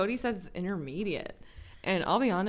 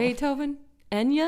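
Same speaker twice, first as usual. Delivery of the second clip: higher and louder, rising on [name]; mock Dewey voice; 7 semitones higher, 5 dB louder than usual. Host recreating Dewey listing classics, hammed up. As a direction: ham up the silly list.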